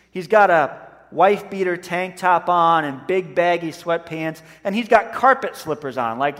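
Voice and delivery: ordinary talk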